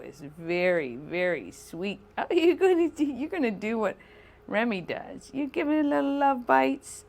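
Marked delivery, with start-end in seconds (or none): 2.2-4.0 pet talk voice. Real, not acted. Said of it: "my, uh, pet talk voice". 5.3-7.0 pet talk voice. Real, not acted. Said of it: "my, uh, pet talk voice".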